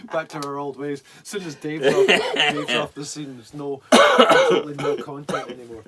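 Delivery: loud, laughing through the words; cracking up while talking. Real, not acted. Laughing at losing focus.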